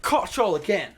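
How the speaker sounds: high, loud mock supervisor gotcha. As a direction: bark it like a busybody catching slackers. Supervisor catches them talking again.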